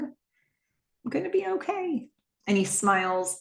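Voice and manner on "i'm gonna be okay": softer, higher and slow, tender; slow it down and hold her with the words; slow soft reassurance. Retold or imitated. Husband hugging his crying wife, slow soft reassurance.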